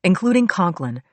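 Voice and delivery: plain reading voice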